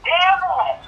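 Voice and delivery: high mock kid yell of pain; high-pitched child yelp; retold kids' yell. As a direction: squeal it like a hurt five-year-old. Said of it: "you hear two kids going".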